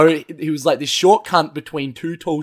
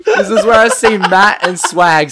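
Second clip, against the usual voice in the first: laughing; 3 semitones higher, 5 dB louder than usual. Real, not acted. Laughing while setting up scene.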